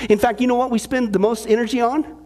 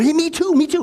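excited; 6 semitones higher than usual. Retold or imitated. Eager joke character, excited.

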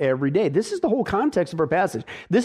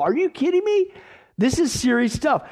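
incredulous; 8 semitones higher than usual. Real, not acted. Preacher mock disbelief.

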